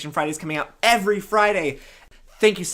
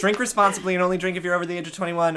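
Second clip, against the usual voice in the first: higher, hurried disclaimer read; rapid disclaimer; 4 semitones higher, much faster than usual. Real, not acted. Host adding a drinking warning at sign-off, rapid disclaimer. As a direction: rattle it off like small print.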